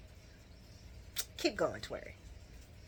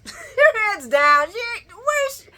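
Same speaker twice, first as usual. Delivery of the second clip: laughing description; 12 semitones higher, 5 dB louder, slower than usual. Real, not acted.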